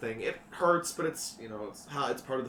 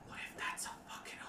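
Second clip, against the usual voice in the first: whisper; 5 semitones higher, 6 dB quieter than usual. Acted. Teen whispers to friend.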